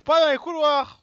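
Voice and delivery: furious shout. Real, not acted. Streamer raging at a chaser in the corridors, shouting. Raging at game chasing him.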